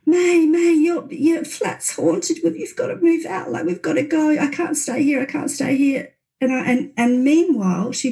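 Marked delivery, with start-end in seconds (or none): 0.0-6.1 high, frantic, begging voice; panicked pleading. Retold or imitated. Scared friend begging May to leave the haunted flat, panicked.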